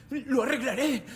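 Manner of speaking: high, loud pleading assurance; agitated pleading; desperate promise. Acted. Man desperately promising to fix it.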